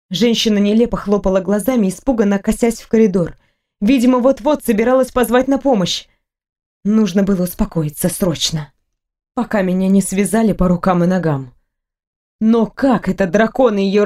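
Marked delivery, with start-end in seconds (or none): none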